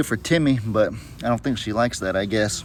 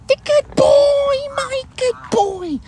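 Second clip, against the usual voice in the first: doting pet voice; much slower than usual. Real, not acted. Owner cooing praise at her cat Hero.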